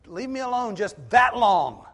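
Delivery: acted loud, high-pitched demand; exasperated outburst. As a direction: cry out in fed-up protest. Voices Job demanding a moment's respite.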